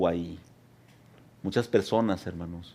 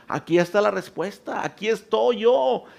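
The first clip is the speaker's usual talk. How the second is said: high, loud call of God from the storm; booming divine call, pitched high; God shouting; 11 semitones higher, 4 dB louder than usual. Acted. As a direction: cry out as God calling his people. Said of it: "Dios nos está gritando".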